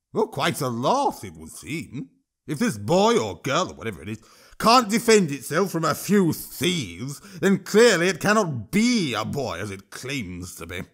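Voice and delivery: troll voice, sneering and sing-song; a troll needling the ministers. Acted. Troll argues before the hall.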